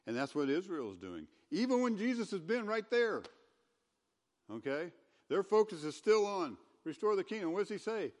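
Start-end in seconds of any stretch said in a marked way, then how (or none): 1.5-8.1 higher, louder preacher's push; preaching loud. Real, not acted. Pastor pressing the congregation, raised and loud. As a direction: drive the point home from the pulpit.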